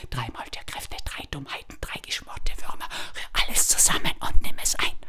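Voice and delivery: low secret whisper of the charm; whispered spell. A narrator's reading. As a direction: whisper the spell as if it must stay hidden. Spell repeated fading.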